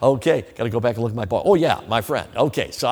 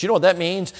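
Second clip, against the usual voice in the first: ordinary delivery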